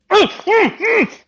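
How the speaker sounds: high pained groans, then a yelp; sudden leg cramp pain; getting a leg cramp. Real, not acted. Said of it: "Oh, leg cramp was vicious".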